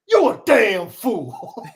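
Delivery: slow, exasperated Booker T impression; Booker T beside himself. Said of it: "Booker T was beside himself".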